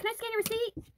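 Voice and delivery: high, sing-song mock clerk voice; imitating the greeter. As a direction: mimic a chirpy store greeter. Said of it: "she's like".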